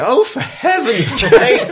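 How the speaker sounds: an official fed up with escapes; exasperated shout. Acted. A shouted reply across the street, exasperated. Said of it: "And you hear".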